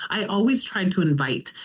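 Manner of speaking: ordinary delivery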